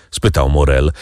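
usual speaking voice

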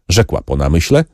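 plain reading voice

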